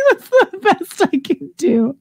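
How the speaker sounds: high, slow words through laughter; laughing. Real, not acted. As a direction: talk while cracking up. Laughing after the gobble performance.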